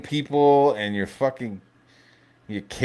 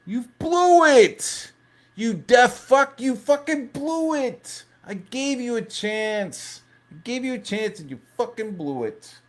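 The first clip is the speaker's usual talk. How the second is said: De Niro impression; 13 semitones higher, 3 dB louder than usual. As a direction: rant like an unhinged movie cop. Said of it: "I need a clip of, uh, Robert De Niro from Copland".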